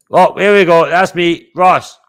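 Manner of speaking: proud streamer showing his photo to Ross; excited reveal. Real, not acted. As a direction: announce it like a big reveal. Finally finds right picture.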